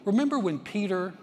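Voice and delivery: loud, high impassioned preaching; preaching at full force. Acted. Pastor urging the congregation, in loud impassioned preaching. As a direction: build the sermon to full force.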